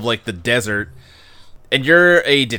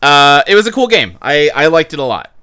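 ordinary delivery

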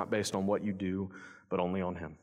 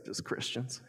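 smirking; 8 dB quieter than usual. Retold or imitated.

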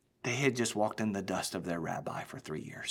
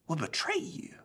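breathless whisper, softer; hushed disbelief; 5 semitones higher, 5 dB quieter than usual. Acted. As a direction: barely dare say the word aloud. Disciple stunned by betrayal news.

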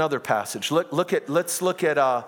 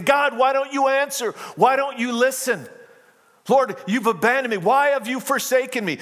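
David crying out; 9 semitones higher than usual. Retold or imitated. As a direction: cry it out like an abandoned man. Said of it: "He's crying out and saying".

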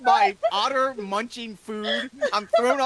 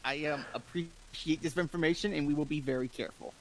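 quiet, measured reply; thoughtful, polite; 6 semitones lower, 10 dB quieter than usual. Acted. Roscoe to the rumor-spreading NPC, quiet and diplomatic. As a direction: thank him carefully and softly. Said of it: "thoughtfully and goes".

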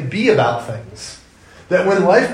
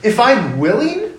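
incredulous echo; 6 semitones higher, 4 dB louder, slower than usual. Retold or imitated. Jesus repeats the plea, incredulous.

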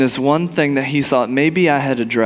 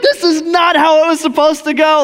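dismayed Moses; 12 semitones higher, 4 dB louder than usual. Retold or imitated. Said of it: "And Moses is like".

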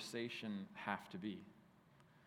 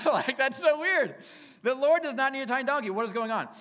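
high, loud incredulous laughing rant; much higher and louder, laughing in disbelief; 12 semitones higher, 11 dB louder than usual. Real, not acted. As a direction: shriek it in amused disbelief.

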